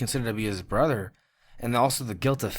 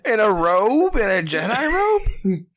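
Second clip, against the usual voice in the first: high, loud disbelieving questions; floored and nearly shouting; real incredulous outburst; 8 semitones higher, 6 dB louder, slower than usual. Real, not acted. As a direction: exclaim it, stretching the last question out. Stunned by sister's absurd guess.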